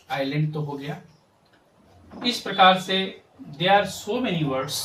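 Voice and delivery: usual speaking voice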